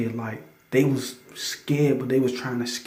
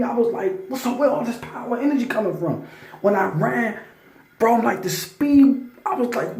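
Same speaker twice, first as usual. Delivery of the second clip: pitched much higher, rushing with amazement; excited retelling; 9 semitones higher than usual. Retold or imitated. Telling listeners about his burst of speed, high excited amazement. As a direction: relive the rush of sudden energy.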